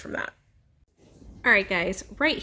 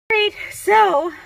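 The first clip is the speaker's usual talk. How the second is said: excited; 14 semitones higher, 8 dB louder than usual. Real, not acted. Excited after the shot.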